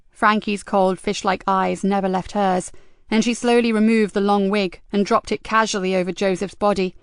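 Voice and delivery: ordinary delivery, nothing marked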